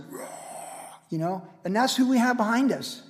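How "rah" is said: breathy imitated lion roar; Aslan's roar. Preacher voices Aslan roaring behind Lucy, imitated roar. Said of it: "they're seeing Aslan just like".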